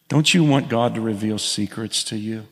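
ordinary talk, nothing marked

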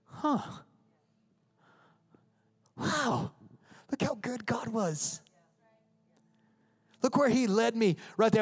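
soft, high, wondering reaction; acted dawning wonder, softly voiced; quiet amazement. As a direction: look back in hushed awe. Looking back amazed after the rapids.

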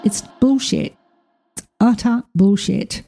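usual speaking voice

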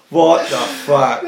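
ordinary talk, nothing marked

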